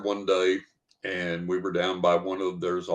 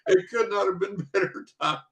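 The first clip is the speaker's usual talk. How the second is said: higher, with a laugh in the middle; laughing teacher; 12 semitones higher than usual. Retold or imitated. Teacher tells the hikers the timing was perfect, laughing. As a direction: mimic her delight, laughing mid-line. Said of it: "And she said".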